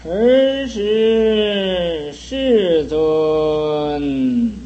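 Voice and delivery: each syllable drawn out very slowly, chanted; slow sutra recitation. Acted. Master reciting the sutra line to his disciples, slow drawn-out chant. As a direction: intone the scripture like liturgy, stretching every word.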